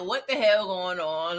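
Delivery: low, slow, put-upon grumble; a touch of a fed-up Duke; mildly annoyed Duke. Retold or imitated. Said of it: "the Duke is like".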